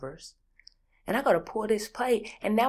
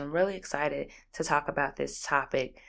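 normal talking voice

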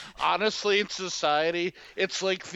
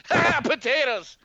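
mock Irish; 8 semitones higher, 4 dB louder than usual. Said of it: "My Irish thing's very one-dimensional".

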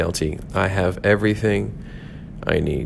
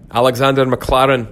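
higher, hammed-up Scottish accent; bad Scottish accent; 4 semitones higher than usual. Shown as a demonstration. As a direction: say the name in a poorly faked Scottish burr. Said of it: "horrific Scottish accent".

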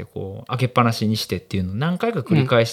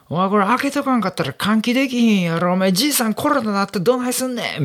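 put-on young guy arguing; 9 semitones higher than usual. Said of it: "みたいな若い兄ちゃんが言うてん".

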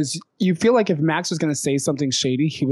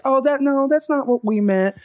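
much higher put-on voice; mock backpedaling; 12 semitones higher than usual. Group shutting up when Pearl defends herself, mock backpedaling. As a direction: imitate sheepish denial in a high pitch. Retold backpedaling after being confronted.

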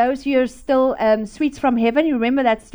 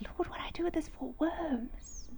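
soft unvoiced plea to God; whispered prayer; 3 semitones higher, 18 dB quieter than usual. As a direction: whisper it like a private prayer at night.

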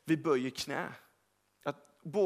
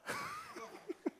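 high laugh; 10 dB quieter than usual. Real, not acted. Laughs after the story.

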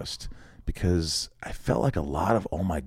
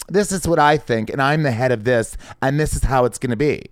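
mock bossy executive; 5 semitones higher, 3 dB louder than usual. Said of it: "some executive going".

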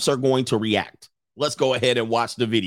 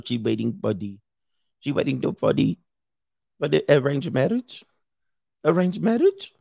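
mock Indian accent. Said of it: "Everything they be saying looks like a question mark".

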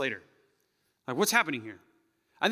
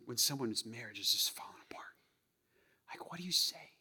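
whisper; 3 semitones lower, 9 dB quieter than usual. Real, not acted. Intimate reflective sermon moment.

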